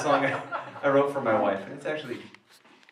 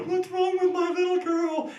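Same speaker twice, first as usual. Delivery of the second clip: much higher, fretting parent voice; worried parents. Acted. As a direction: panic over your little girl.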